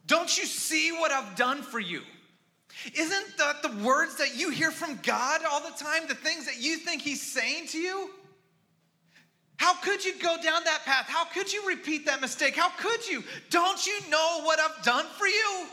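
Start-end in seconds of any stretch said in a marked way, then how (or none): 0.0-2.0 loud, high anguished plea; pained plea. Acted. Preacher voicing God pleading with his people, loud and high. 9.6-15.7 loud, high pleading shout; anguished rebuke. Acted. Preacher voicing God's grief at his people, shouting high.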